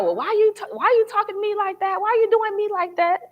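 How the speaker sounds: high-pitched mock indignation; indignant confrontation. Speaker replaying a conflict with friends, indignant and high. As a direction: act out challenging someone, voice jumping high.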